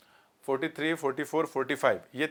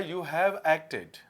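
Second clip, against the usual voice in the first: slow dictation; much slower than usual. Shown as a demonstration. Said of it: "this is a verb".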